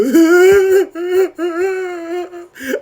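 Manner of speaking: long, high mock bawling; imitated wailing sob. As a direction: wail like someone crying loudly. Imitating girlfriend crying at the door.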